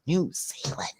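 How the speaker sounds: breathless whispered "New Zealand"; stunned hush at a fan from abroad; awed whisper. Retold or imitated. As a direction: whisper it like you can't believe it. Stunned at global recognition.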